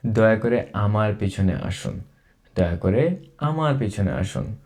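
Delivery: normal talking voice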